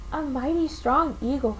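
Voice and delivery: ordinary delivery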